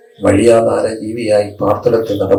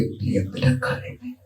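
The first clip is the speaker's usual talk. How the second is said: tender question; 9 dB quieter than usual. Acted.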